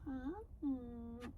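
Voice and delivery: high, soft coo to a pet; sweet talk to the dog; soft pet voice, asking the dog. Real, not acted.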